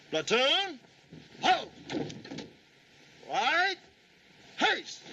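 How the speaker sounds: barked high drill commands; drawn out parade commands. Shown as a demonstration. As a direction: bark each command with snap. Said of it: "get more of a bark out of your voice like this".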